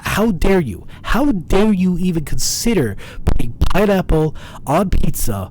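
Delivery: loud outraged rant; hammed-up fury at pineapple pizza; shaking with anger. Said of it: "I'm only doing this as a meme".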